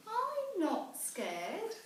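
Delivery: high and slow, bravely insisting; a small monster talking herself brave; little Gruffalo child. Acted. Child braves the dark wood.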